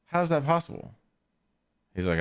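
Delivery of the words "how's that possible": disbelief. Retold or imitated. Retold own disbelief to friend.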